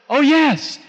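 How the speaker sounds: high, mock-fervent yes; emphatic agreement. Woman answering whether the Word works on hearts, fervently. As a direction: exclaim it like an over-keen believer.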